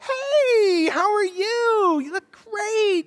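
high, drawn-out gushing greeting; fake cheerfulness. Couple hiding a fight greets friends, falsely cheery. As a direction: greet them in a sing-song, over-friendly put-on pitch. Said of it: "fake like it's not there".

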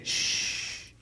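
breathy parental shush. Retold or imitated. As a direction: hush them like an annoyed parent. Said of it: "their way of saying".

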